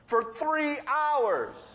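usual speaking voice

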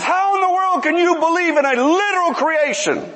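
high-pitched mock anger, half laughing; angry priest.

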